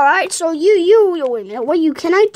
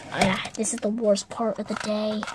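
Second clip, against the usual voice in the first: ordinary talk, nothing marked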